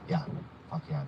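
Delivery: ordinary talk, nothing marked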